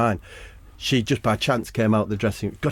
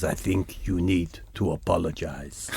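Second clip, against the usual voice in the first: low, soft menacing voice of a big man; deep tough guy; 6 semitones lower, 7 dB quieter than usual. Acted. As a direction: lean in low and threaten quietly. Deep gangster voice.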